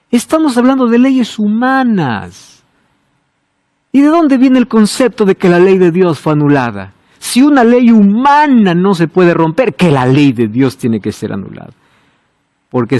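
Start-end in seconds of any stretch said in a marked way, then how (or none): none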